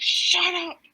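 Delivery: loud, high mock shout; imitated yell. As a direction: act out yelling at a noisy dog to stop. Acts out Haddock yelling at Snowy.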